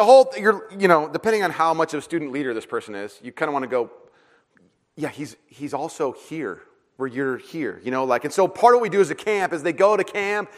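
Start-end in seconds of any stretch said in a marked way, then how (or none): 5.0-7.8 gentle reassurance. Retold or imitated. Pastor's gentle reply to teen.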